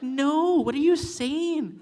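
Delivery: high, slow, laughing disbelief; elder's retort. Retold or imitated. Elder repeating her disbelief to the learner, laughing. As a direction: draw it out like a baffled elder. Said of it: "She's like".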